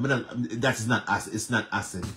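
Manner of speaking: ordinary talk, nothing marked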